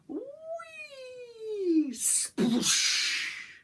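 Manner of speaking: high whoop then loud sploosh; playful jump-and-splash noises; whee and splash. Acted. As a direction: sound effects of a jump. Fox jumps into river.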